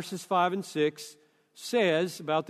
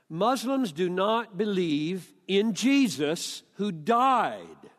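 usual speaking voice

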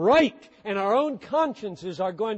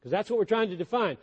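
usual speaking voice

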